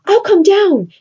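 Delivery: mock lady's voice, high and bright; eager Roxanne. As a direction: play a keen young lady calling down. Teacher voices Roxanne's eager reply.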